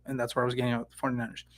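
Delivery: talking with something in mouth; words blurred by something in the mouth; mouth full. Real, not acted. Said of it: "Sorry, I got stuff in my mouth".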